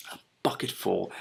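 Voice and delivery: bucketful said quietly, in disbelief; amazed echo. Real, not acted. Reader marvelling at dragon portions, quietly amazed. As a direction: savour the absurd amount.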